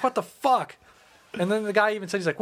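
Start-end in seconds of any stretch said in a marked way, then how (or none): 0.0-0.8 high, stunned outburst; incredulous. Retold or imitated. Reacting to the crowd at the meeting, high incredulous. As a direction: throw it out in disbelief, pitched up. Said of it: "And I'm just like".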